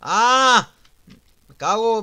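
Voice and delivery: loud, high shout of alarm; startled yell. Real, not acted. Player caught out in the game, startled yell.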